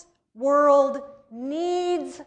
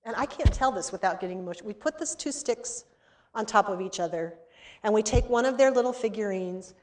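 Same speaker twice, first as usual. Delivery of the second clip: ordinary talk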